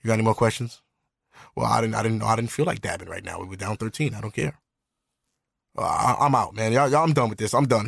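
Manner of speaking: low, monotone mock sulk; sore-loser voice. Losing quarterback brushes off reporters, low and sullen. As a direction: imitate a fed-up athlete cutting the interview short. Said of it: "When he lose".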